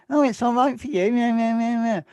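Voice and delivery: high-pitched mocking imitation of a grumbler; mocking whine. People dismissing his hard work, in a high mocking voice. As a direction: mimic the complaint, high and sing-song.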